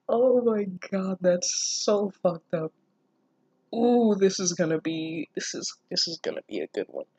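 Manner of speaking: usual speaking voice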